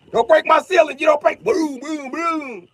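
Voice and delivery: very high loud shriek; high panicked yell. Retold or imitated. Lex shrieking at Superman breaking his ceiling. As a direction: scream it as he smashes through.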